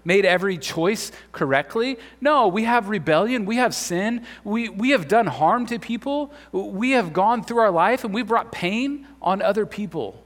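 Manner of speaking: fervent sermon delivery; preaching. Real, not acted. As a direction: drive home the confession from the pulpit. Pastor preaching confession.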